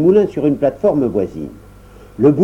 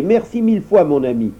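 dwarf; 7 semitones higher than usual. Acted. Dwarf thanks.

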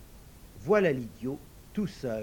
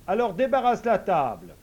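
lightly voiced idiot ordering; 6 semitones higher, 8 dB louder than usual.

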